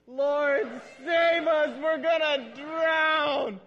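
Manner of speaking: screaming high and loud in terror; panicked shout. Shown as a demonstration. Disciple screaming to Jesus in the storm, panicked shout. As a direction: yell it like waves are swamping the boat. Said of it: "that's the way that I would say it if I'm standing in the boat".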